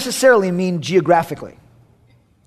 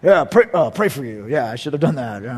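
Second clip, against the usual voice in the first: low mumbled mock agreement; sheepish dad; 9 semitones lower, 3 dB quieter than usual. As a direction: echo it awkwardly, dropping low and quiet. Sheepish dad echoing mama.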